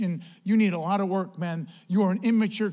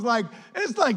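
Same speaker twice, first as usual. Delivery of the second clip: normal talking voice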